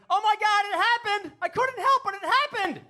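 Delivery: very high, loud mock scream; hammed-up kid shrieking in panic; panicked screaming. Friend on a school trip who had an accident, mock panicked screaming. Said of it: "my friend Tony just started screaming".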